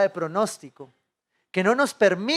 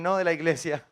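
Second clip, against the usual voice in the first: ordinary talk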